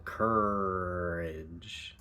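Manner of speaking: "Courage" with the R coloring the whole first vowel; R-colored vowel. Shown as a demonstration. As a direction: hold the R through the whole vowel. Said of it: "The R is happening for the entire duration".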